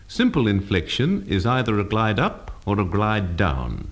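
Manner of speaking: ordinary delivery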